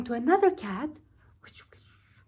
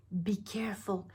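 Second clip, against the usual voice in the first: hushed warning; whispering; 7 dB quieter than usual. Acted. Warning inside the plan.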